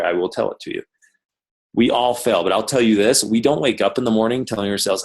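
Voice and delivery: ordinary talk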